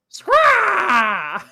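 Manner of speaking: a high, loud, squawking falcon cry; falcon screech. Retold or imitated. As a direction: screech like a bird of prey on the spot. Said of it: "what kind of sound does a falcon make?".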